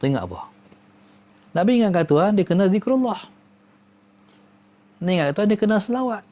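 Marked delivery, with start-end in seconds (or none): 1.5-6.2 rushed. Real, not acted. Rapid list of duties.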